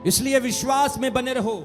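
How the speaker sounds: ordinary talk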